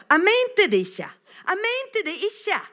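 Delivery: high-pitched call after a friend; desperate shouting. Retold or imitated. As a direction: cry it out in regret across a distance. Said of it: "Og jeg roper". (fortvilet, høyt rop)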